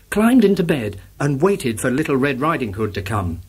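usual speaking voice

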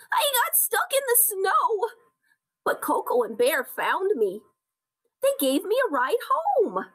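high, calling little-animal voice; Baby Deer. A narrator's reading. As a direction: tell it in a small, high voice. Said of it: "shouted Baby Deer".

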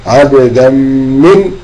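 عبدا من slowed right down, the tanween merged into من with a held nasal; slow tajweed demo. Shown as a demonstration. Teacher models the tanween merge for students, slow held nasal. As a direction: stretch عبدا من to show the merge. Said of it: "أدي ده تنوين أهو".

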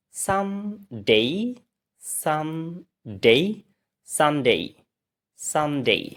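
"সানডে" as san-dei, split and rising, then whole; slow rising "সানডে" twice, then two falling takes; practice drill. Shown as a demonstration. Teacher leads repeat practice, "সানডে" stretched then joined. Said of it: "এবার আবার practice করি".

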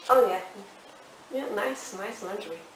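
ordinary talk

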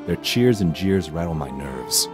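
ordinary delivery, nothing marked

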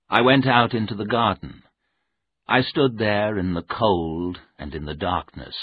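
ordinary delivery, nothing marked

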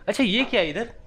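normal talking voice

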